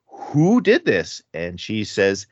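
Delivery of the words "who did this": mock outrage, high and drawn out; angry king. King demanding the culprit from his queen, mock angry. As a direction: demand it slowly, pitch leaping up. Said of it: "the king immediately gets angry".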